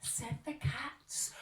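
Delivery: plain reading voice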